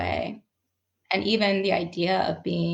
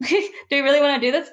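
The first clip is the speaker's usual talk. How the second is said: laughing; 6 semitones higher, 6 dB louder, much faster than usual. Retold or imitated. Laughing while retelling her reply.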